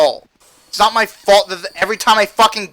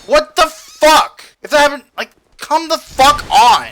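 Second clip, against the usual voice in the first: raging gamer; 6 semitones higher, 4 dB louder than usual. Real, not acted. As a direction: explode at the game. Raging at game.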